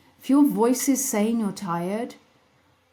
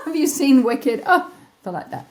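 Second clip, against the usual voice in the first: hint of Galinda; 5 semitones higher, 5 dB louder than usual. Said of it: "I feel like Galinda".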